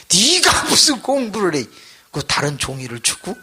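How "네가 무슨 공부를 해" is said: scoffing teacher. Retold or imitated.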